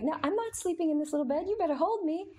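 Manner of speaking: put-on high, quick, demanding baby voice; bossy baby voice. Mom voicing her baby refusing the crib, high and bossy. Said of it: "she would be like".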